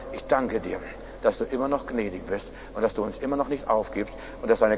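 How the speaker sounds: soft, low prayer of thanks; subdued, reverent voice; humble prayer. Acted. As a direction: quietly address God with gratitude.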